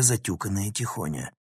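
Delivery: ordinary delivery, nothing marked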